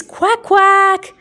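high, loud quacking duck voice. Pretend. Teacher voicing the duck for kids, high and loud. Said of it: "And the duck says".

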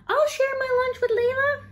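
put-on a keen kid offering to help; squeaky, eager schoolgirl voice; eager classmate. A narrator's reading. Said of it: "Samantha volunteered".

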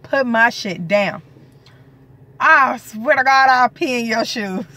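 half-laughing loud threat to husband; playful threat. Real, not acted. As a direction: warn him with a grin in the voice.

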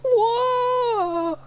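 high startled yell. A narrator's reading. James careering toward the river, yelling. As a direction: lose control and yell. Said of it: "yelled James".

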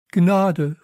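high, loud plea for mercy; frightened cry. A narrator's reading. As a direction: cry out begging to be spared. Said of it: "rief der Mann". (ängstlicher, lauter Ruf)

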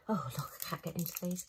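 quick whispered grumble to herself; fast whisper. Real, not acted. Whispered grumble at a packet she can't open. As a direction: hurry it under your breath, half to yourself. Muttering at stubborn battery packet.

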